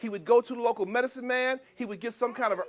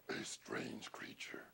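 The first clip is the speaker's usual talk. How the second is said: hushed title; 21 dB quieter, slower than usual. Acted. Storyteller to children, whispering the title. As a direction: whisper the title, slow and eerie.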